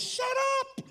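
high, loud sharp command; spirit man commanding. Retold or imitated. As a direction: shout down the doubting flesh. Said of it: "my spirit man says".

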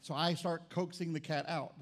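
ordinary talk, nothing marked

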